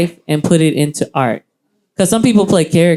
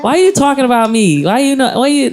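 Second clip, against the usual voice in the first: high, hammed-up New York accent; 8 semitones higher than usual. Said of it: "that was my New York accent".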